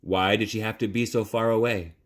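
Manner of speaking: ordinary delivery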